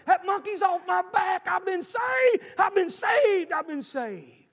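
slow, high, loud joyful holler. Retold or imitated. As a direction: shout it out, then let it settle. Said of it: "he hollered".

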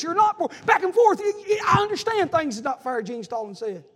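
loud, high, rising preacher's cry; full-force gospel preaching; fiery preaching. Acted. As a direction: preach it hard to the congregation.

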